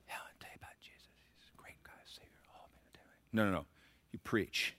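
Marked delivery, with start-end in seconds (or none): 0.1-3.2 barely audible, hurried whisper; mock timid whisper. Preacher mocking timid evangelism to people, meek whisper. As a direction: whisper it like a meek, hesitant witness. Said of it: "You don't walk up to people and are like".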